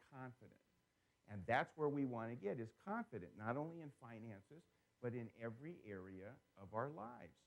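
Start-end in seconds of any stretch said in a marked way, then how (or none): none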